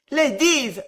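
high, loud desperate call; vociferating. A narrator's reading. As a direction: shout for the crowd's attention. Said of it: "vociféra monsieur Pickwick".

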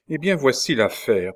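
ordinary delivery

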